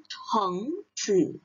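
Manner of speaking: "chengzi" slow, with a breathy aspirated ch. Shown as a demonstration. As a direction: stress the aspiration on "ch". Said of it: "ada H-nya". (ucapkan "chengzi" pelan dengan hembusan pada ch)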